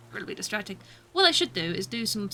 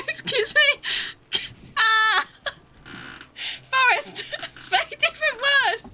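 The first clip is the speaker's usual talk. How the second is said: high, loud, squealed through laughter; helpless laughter; 11 semitones higher, 4 dB louder than usual. Real, not acted. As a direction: squeeze the words out between real laughs. Helpless laughing fit after a slip.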